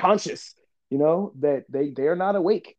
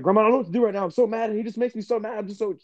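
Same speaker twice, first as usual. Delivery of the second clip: higher-pitched mock rant to grandma; angry venting; 7 semitones higher, faster than usual. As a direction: re-enact a worked-up kid's angry outburst. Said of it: "talking fast, angry".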